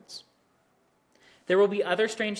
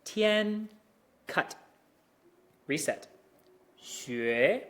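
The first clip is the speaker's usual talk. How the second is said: step by step; 3 semitones higher, much slower than usual. Shown as a demonstration. Teacher breaking 天学 into parts to show the reset. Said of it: "that resetting really, really comes in".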